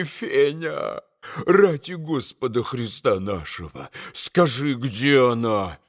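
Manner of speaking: pleading. A narrator's reading. Pleading on knees.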